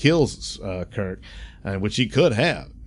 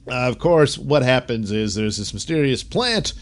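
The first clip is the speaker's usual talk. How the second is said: ordinary delivery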